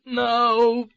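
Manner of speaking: a high wail; wailed protest. A narrator's reading. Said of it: "I wailed the protest".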